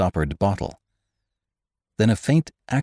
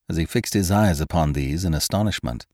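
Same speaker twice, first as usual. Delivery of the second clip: plain reading voice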